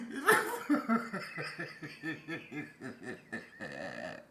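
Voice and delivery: long trailing giggle fit; uncontrollable laughter. Real, not acted. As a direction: laugh till it runs out. Breaks up at own story.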